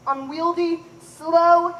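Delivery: ordinary delivery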